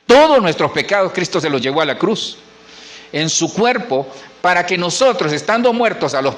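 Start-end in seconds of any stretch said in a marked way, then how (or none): none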